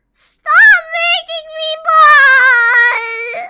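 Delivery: slow, high wailing cry; wailing shout. Pretend. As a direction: wail it out, hammed-up. Wailing plea.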